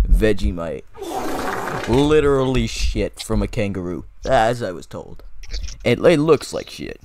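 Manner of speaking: loud, hammed-up American presenter voice; American vlogger. Host shows Vegemite to viewers, exaggerated American accent. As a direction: present it loudly as a brash American YouTuber. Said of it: "Okay, I'm American now".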